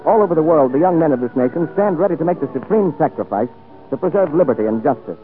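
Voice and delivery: usual speaking voice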